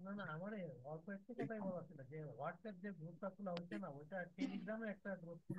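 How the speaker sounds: faint, muffled call audio; distant voice on a bad line; over the phone. Real, not acted. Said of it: "শোনা যাচ্ছে না খুব ভালোভাবে".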